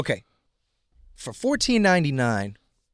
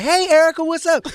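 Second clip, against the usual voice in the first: much higher and louder eager hello; greeting a star he admires, pitched way up; overexcited greeting; 8 dB louder than usual. Retold or imitated. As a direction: burst out with a big high hi. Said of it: "I'm all happy".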